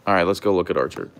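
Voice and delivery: ordinary talk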